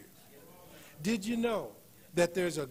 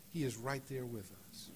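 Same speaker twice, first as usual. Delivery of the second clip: ordinary talk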